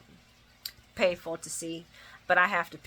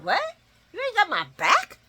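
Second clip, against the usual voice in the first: mock shocked Sonny; 12 semitones higher than usual. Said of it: "Sonny looking all shocked".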